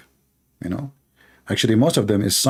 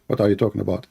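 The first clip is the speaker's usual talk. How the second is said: normal talking voice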